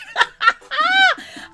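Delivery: high burst of real laughter; big laugh. Real, not acted. Laughing out loud at Wendy's diss. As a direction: crack up at the gossip.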